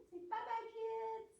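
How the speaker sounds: sing-song wave goodbye; squeaky farewell for little ones; high bye-bye. Shown as a demonstration. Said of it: "Okay, firemen".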